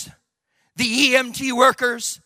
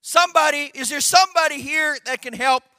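raised, higher-pitched urgent call; calling out for help; 6 semitones higher, 4 dB louder than usual. Retold or imitated. Family crying out for a doctor in the building, urgent call. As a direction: shout out for help across a building. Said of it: "they began to call out for help".

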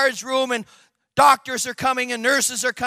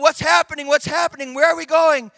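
higher, louder confused calling; 5 semitones higher, 5 dB louder than usual. Retold or imitated. Patient on the gurney asking what's happening, calling out. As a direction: fire off bewildered, urgent questions. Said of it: "you began to call out".

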